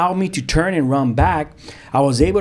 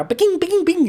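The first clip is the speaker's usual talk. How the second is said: ping noises pitched far higher; bullets pinging; slower than usual. Retold or imitated. Describing bullets striking the car, high pinging sounds. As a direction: sing out the bullet hits.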